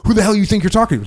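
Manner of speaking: mock angry comeback. Teammate snapping back at a barked order, mock angry. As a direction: bristle like he's been insulted. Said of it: "and I go".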